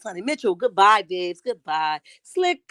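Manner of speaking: ordinary delivery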